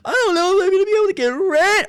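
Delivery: very high, whiny mocking voice; mock whining complainer. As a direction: squeak it like a crybaby worried about rent.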